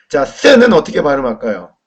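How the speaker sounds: ordinary talk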